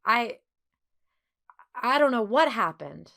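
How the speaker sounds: halting, broken start with nervous repeats; stammering. A narrator's reading. Bernard nervously explaining to the girls, stammering. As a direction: stumble over the first words. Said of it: "he stammered".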